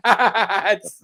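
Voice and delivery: laughing through the words. Real, not acted. Podcaster laughing at the Morgan meme, laughing. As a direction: chuckle it out.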